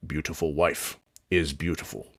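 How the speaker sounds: put-on old orc praising his wife, deep and soft; old orc Stone. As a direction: rumble it like a doting old orc. Stone speaks.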